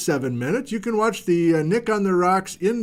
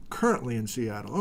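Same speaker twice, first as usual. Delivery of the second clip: softer, lower echo of the line; murmured aside while reading; quiet repeat; 7 semitones lower, 7 dB quieter than usual. Real, not acted. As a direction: repeat it half to yourself. Repeats the location to himself.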